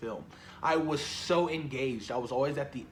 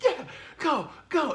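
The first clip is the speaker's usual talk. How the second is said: much higher excited cheer, yelled; cheering shout; 12 semitones higher than usual. Retold or imitated. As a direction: shout at the screen like in the cinema. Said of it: "I was like".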